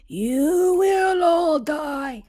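very slow, airy ghost moan. Shown as a demonstration. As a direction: stretch it out like a ghost. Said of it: "That's not how a ghost talks".

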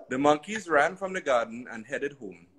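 plain reading voice

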